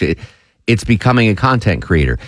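ordinary delivery

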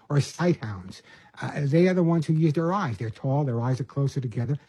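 normal talking voice

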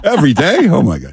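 high laughing "Every day?"; laughing disbelief. Real, not acted. Incredulous at daily podcast.

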